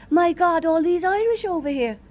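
imitating office girls. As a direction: toss it off like a scandalised office girl. Office girls exclaiming, retold.

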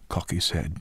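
usual speaking voice

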